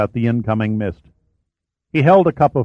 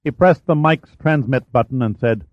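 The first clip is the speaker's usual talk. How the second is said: usual speaking voice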